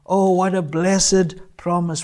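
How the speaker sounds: ordinary talk, nothing marked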